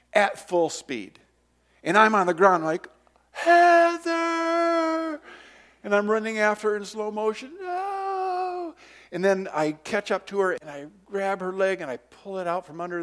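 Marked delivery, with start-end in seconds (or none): 3.3-5.2 long high loud call. Retold or imitated. Boy on the ground yelling for his sister. 7.6-8.7 high stretched no; desperate cry. Retold or imitated. Boy chasing the mower, crying out a high no. Running after sister.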